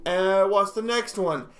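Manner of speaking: usual speaking voice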